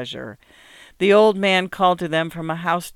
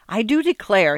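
higher, louder sudden delight; excited exclaim; 6 semitones higher, 5 dB louder than usual. A narrator's reading. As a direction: burst out with glad surprise. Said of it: "he exclaimed excitedly".

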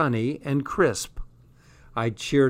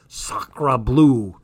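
mock French; 4 dB louder than usual.

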